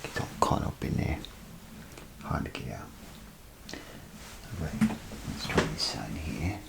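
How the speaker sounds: normal talking voice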